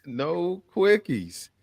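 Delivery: slow, weighted repeat; slow emphatic. Real, not acted. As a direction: lean on each word.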